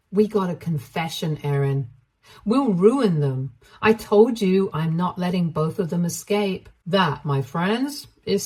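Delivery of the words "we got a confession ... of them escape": usual speaking voice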